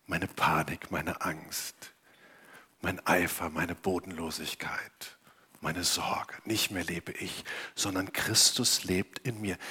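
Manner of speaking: tiefer, sehr leise, teils geflüstert; leises Flüstern. Acted. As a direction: lass die Sorgen flüsternd los. Leises Gebet, Sorgen abgeben. (leise geflüstertes Bekenntnis)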